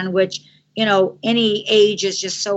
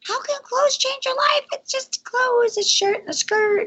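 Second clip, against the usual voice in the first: high, breathy half-whispered scoff at the idea; mock her own old doubt in a much higher voice; incredulous high whisper, brushing it off; scoffing disbelief. Retelling her own early doubt.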